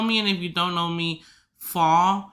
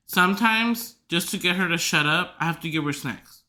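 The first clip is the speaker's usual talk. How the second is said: ordinary talk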